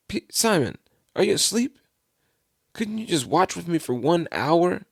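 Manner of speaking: high-pitched, incredulous questioning; mild scolding, voice pitched right up; reproachful disbelief. Retold or imitated. Jesus finds disciples asleep, incredulous reproach.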